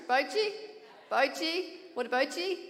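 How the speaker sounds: loud, high Irish "Bout ye?" with a rising lilt; Irish greeting. Shown as a demonstration. Irish preacher teaching the congregation a greeting, calling out. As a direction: call out "Bout ye?" like a Belfast greeting. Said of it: "few wee Irish sayings".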